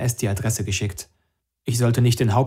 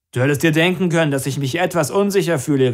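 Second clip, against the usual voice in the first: quick, raised retort, higher pitch; calling back; 5 semitones higher, 5 dB louder than usual. A narrator's reading. Max answers the reproach through the door, calling back. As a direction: call back your defence through a door. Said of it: "rief Max".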